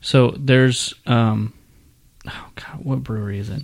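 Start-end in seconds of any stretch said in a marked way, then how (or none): 2.3-3.6 whispering. Real, not acted. Whispering aside to self.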